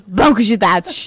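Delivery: loud, slow, drawn-out blurt; shouted nonsense reply. Retold or imitated. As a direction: declare it loudly like a drunk friend. Imitating friend's nonsense shouted reply. (resposta alta e arrastada, imitando)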